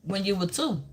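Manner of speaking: normal talking voice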